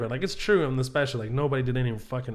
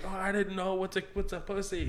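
higher, soft, naive question; innocent little kid; 5 semitones higher, 7 dB quieter than usual. Retold or imitated. Little boy asking his brother what it means, softly innocent. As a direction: ask it small and clueless.

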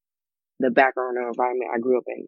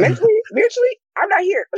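very high, louder proclamation; mock dramatic; 4 dB louder than usual. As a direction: declare it with hammed-up drama. Joking she's mentally in Africa.

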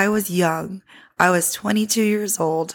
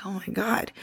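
soft whispered disbelief; stunned whisper; 9 dB quieter than usual. Retold or imitated. Waitress greeted by name at Nordstrom, whispered shock. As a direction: breathe it out in shock.

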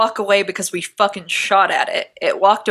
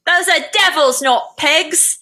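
mild mock scream; 8 semitones higher, slower than usual. A narrator's reading. Assistant screams about the beasts, mild mock scream. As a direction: read out a scream without full volume. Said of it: "The assistant accompanying Ogie screamed".

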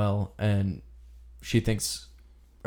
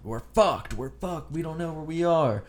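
a mock freak-out, pitched higher; panicky Josh; 7 semitones higher, 3 dB quieter than usual. Retelling Josh's breakdown in the woods, mock panic. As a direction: play a lost hiker losing his nerve, half-joking.

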